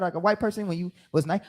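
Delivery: ordinary talk, nothing marked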